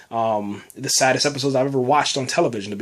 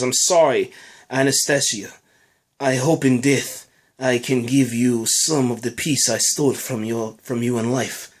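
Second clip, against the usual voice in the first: deliberate, lower-pitched mock Russian accent; 3 semitones lower than usual. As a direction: read it with a put-on Russian accent. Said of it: "That was my, you know, Russian accent".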